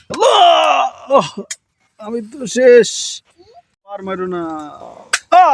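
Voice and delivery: high, hammed-up dying cry; mock dying. Father playing shot by son's toy gun, hammed-up death cry. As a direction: play shot and finished, overacted.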